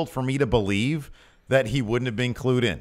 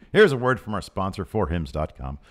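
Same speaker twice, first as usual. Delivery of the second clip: higher, punched-up sponsor read; 6 semitones higher, 5 dB louder than usual. Real, not acted. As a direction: sell it with energy, hitting key figures. Reads the sponsor's ad.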